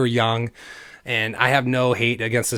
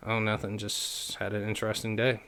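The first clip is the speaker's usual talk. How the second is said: re-enacted soft, withdrawn answer; evasive, downcast; 8 dB quieter than usual. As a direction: brush the question off quietly. Evasive answer to parents, avoiding eyes.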